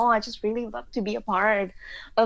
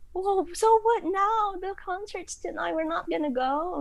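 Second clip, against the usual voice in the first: high, hammed-up teen dismay; mock despair; 10 semitones higher than usual. Girls at school realising they'll miss the concert, mock despairing. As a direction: wail it like the world is ending. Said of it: "We were so dramatic".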